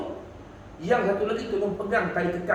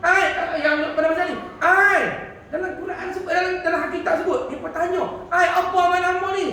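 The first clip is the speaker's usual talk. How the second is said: excited curious asking; 8 semitones higher than usual. Retold or imitated. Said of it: "ahli syurga ni tanya ni".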